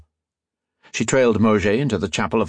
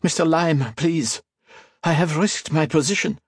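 pleading; 8 semitones higher than usual. A narrator's reading. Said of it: "Mauger pleaded".

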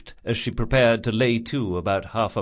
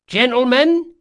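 higher, muffled call through a door; muffled crew woman; 7 dB louder than usual. Acted. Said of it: "A crew woman's muffled voice came through the door".

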